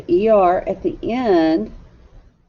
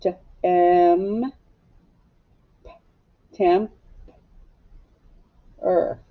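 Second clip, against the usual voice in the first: sounding out slowly; much slower than usual. Shown as a demonstration. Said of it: "Let's write it".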